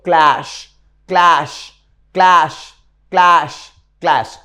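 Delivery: क्लैश said clearly, once per beat; slow repeated drill. Shown as a demonstration. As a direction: repeat क्लैश slowly for students to copy. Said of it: "सारे बच्चे मेरे साथ बोलो". (क्लैश को धीरे-धीरे दोहराना)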